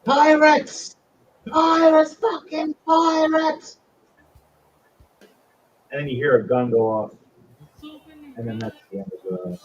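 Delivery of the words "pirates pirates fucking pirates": very high, loud scream; screaming alarm. Acted. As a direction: scream the warning at full pitch.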